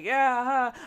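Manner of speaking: awkward forced reply. Retold or imitated. Awkward smile in elevator.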